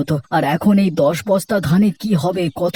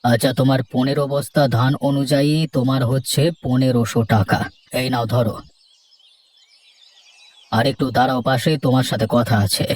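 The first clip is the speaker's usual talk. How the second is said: lower, measured headman's voice; village headman; 6 semitones lower than usual. Acted. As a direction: speak down to the farmers as the headman, lower. Village headman pays farmers.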